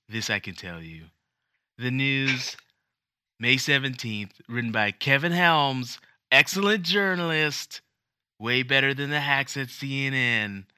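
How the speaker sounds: hammed-up Trump impression. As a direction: brag about the article like Trump. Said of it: "I'm gonna read Monday's news in Trump's voice".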